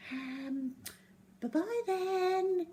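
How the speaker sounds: high, slow, timid little voice; unsure little girl. Acted. Zoe leaving the laughing class, high and timid. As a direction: say goodbye meekly, not knowing what else to say.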